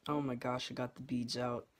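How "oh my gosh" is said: usual speaking voice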